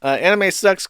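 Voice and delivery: ordinary talk, nothing marked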